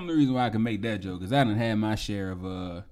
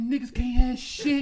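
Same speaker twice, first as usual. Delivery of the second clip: slow, higher exasperated complaint; 12 semitones higher, slower than usual. Retold or imitated. Friend over his wrecked car, exasperated. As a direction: drag it out in disgust. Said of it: "I'm like".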